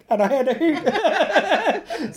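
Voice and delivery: laughing through the words. Real, not acted. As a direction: giggle it out, slow. Laughing at the memory.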